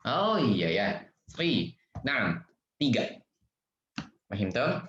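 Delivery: ordinary talk, nothing marked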